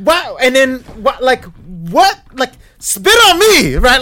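high, loud, slow taunting challenge; angry challenge. Retold or imitated. Challenging housemates to spit on him, angry shout. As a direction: dare them to try it on you. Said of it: "I just lose my stuff".

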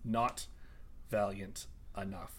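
very low, soft villain verdict; quiet, disappointed dismissal. Acted. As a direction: drop it quietly, with pauses between words. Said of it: "you sound so disappointed".

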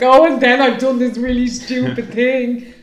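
loud, slow, hammed-up wail; dramatic confession. To her husband at dinner, loud hammed-up confession. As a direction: hams up a loud, drawn-out confession. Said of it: "then I was like".

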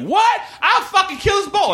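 high-pitched hammed-up shriek; mock scorned fury. Girlfriend turning on the man who rejected her, mock fury. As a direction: imitate a woman scorned, shrieking her threat. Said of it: "classic Hell Hath No Fury".

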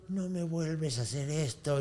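slow, soft acted warning; menacing threat. Wronged avenger with a match behind the husband, menacing threat.

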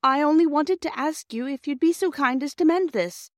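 put-on high girlish hopeful voice; cheered girl. A narrator's reading. Bobby asking the driver to mend the toy, high and cheered. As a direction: ask eagerly, a little brighter. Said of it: "much cheered".

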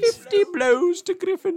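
very high, slow announcing; grand headmaster awarding points; Dumbledore voice. Pretend. Parodying Hogwarts house points.

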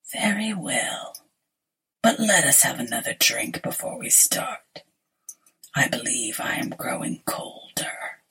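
whispered, low sinister stranger; low looming threat. Acted. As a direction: breathe it like a pale ghost. Pale stranger whispers his lines.

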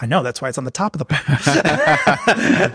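amused calm. Retold or imitated. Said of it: "I'm like".

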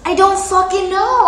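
high, loud exasperated cry; loud outburst. Real, not acted. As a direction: blurt it out loud. Defensive outburst, laughing.

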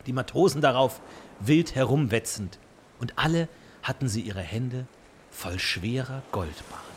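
ordinary talk

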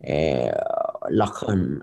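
a hint of Anil Kapoor reciting his famous film lines; Anil Kapoor impression. Host doing Anil Kapoor's film lines for a listener, slow and filmy. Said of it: "do an imitation of Anil Kapoor".

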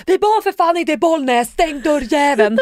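high-pitched, sweary re-enacted shout; retold yelling. Woman yelling at Andreas about the open door, exasperated. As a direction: holler it in exasperation, dragging out the end. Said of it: "jag brukar skrika till Andreas".

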